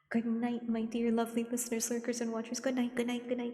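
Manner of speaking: high, lilting good night; high, fond farewell to the chat; fond sign-off. Acted. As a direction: sing out good night to the viewers. Sign-off to listeners.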